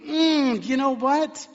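a high, sly, insinuating "Mm, you know what?"; evil one's voice. Retold or imitated. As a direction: tease it like a tempter. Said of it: "the evil one is there to say".

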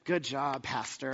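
low, soft mock praise; retold dry sarcasm, dropped low; sarcastic. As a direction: imitate a student's quiet sarcastic congratulations.

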